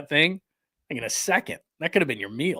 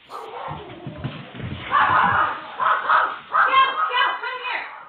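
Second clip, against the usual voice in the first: long breathy giggle at the clip; helpless high-pitched giggling; high airy laugh. Real, not acted. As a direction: burst out in a high, airless laugh. Watching a funny bear clip.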